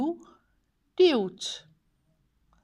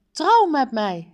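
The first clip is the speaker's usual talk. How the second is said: loud, higher, forceful order; threatening demand; 7 semitones higher, 9 dB louder than usual. Acted. Villain to princess, loud threatening demand. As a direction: bark it as a threat.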